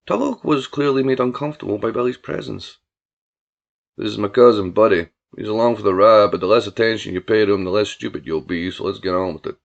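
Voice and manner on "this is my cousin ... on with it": low-voiced country boss; brusque, disdainful Billy. Billy introducing Tulloch to his cousin, brusque low voice. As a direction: introduce him with open contempt.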